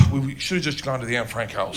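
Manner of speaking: low, soft retold aside; hushed aside. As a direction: lean in and confide to friends. Leaning over to friends, an aside.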